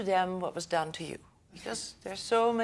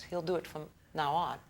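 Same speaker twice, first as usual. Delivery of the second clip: ordinary talk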